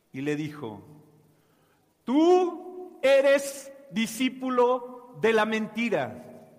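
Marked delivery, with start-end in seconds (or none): 2.0-6.5 bold defiance. Acted. Girl defies the persecutor.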